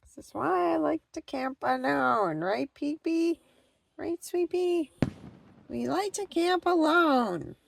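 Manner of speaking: high cooing voice to the pets; high sing-song pet talk. Real, not acted. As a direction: coo to your pets like babies. Talking baby talk to pets.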